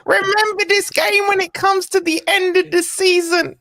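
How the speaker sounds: high-pitched mock Scouse impression; Scouser accent. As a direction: put on a high Scouse voice, imitating a pundit. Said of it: "you're doing a Scouser accent".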